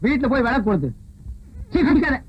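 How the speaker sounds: put-on loud, furious yelling at a drunk; angry shouting. Man shouts at the drunk who fell at his feet, furious and loud. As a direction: bellow it out in disgust.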